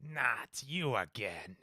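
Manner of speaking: slow, soft skeleton voice; clattering jaw cackling skull. Acted. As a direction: drag it out like a skeleton. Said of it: "the skeleton voice".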